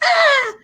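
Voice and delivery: very high mock shriek; woman-like scream. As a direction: imitate a child's piercing scream. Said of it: "he fucking screamed like a woman".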